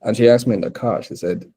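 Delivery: ordinary talk, nothing marked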